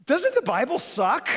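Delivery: high, loud, drawn-out gripe; hammed-up sarcastic whine; mock complaint. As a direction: slowly play up a doubter's disappointment. Voices a doubter's imagined reaction.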